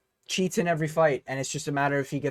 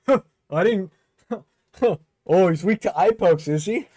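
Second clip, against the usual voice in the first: sly, gloating chuckle, a touch louder; John Jones; 3 semitones higher, 6 dB louder than usual. Retold or imitated. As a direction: savour a rival's weakness, lightly voiced. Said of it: "John Jones is probably licking his lips like".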